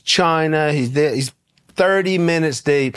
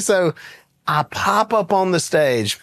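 normal talking voice

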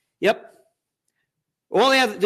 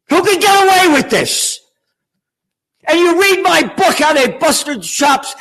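high, angry challenge; outraged; 6 semitones higher than usual. Real, not acted. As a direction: demand an answer in raised pitch. Outraged rant at politicians.

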